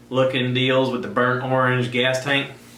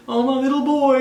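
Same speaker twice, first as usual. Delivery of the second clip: much higher, cooing granny voice; doting grandparent; 13 semitones higher, 4 dB louder than usual. Retold or imitated. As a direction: coo over a grandchild. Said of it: "my grandparents who".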